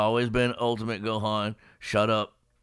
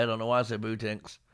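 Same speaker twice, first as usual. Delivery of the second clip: usual speaking voice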